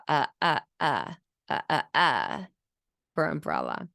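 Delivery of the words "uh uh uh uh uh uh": "Uh, uh" vowels only, consonants dropped. Retold or imitated. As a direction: toddler saying umbrella with vowels only. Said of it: "they're gonna say".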